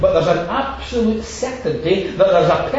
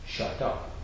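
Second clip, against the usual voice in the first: hushed shut up; 5 semitones lower, 11 dB quieter than usual. Retold or imitated. Priest silencing Amos in retelling.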